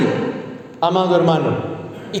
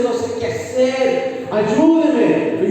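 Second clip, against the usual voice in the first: tearful plea; 3 semitones higher, much slower than usual. Retold or imitated. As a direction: plead like a broken husband near tears, lightly acted. (súplica suave y lenta, casi llorando)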